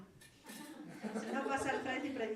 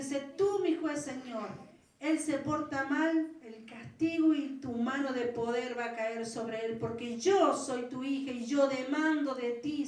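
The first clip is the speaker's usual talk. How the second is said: bold demanding prayer; 5 dB louder than usual. Retold or imitated. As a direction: demand God's justice with nerve. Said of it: "Así le dije al Señor, qué coraje".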